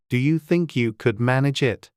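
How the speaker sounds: usual speaking voice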